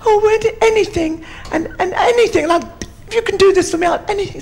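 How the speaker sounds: very high, desperate animal voice; pleading elephant. Acted. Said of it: "And the elephant said".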